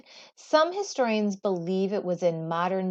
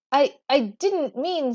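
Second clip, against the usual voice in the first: halting stammer, a touch higher; 7 semitones higher, 5 dB louder than usual. Acted. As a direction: stumble over the first words nervously. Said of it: "Senmut stammered".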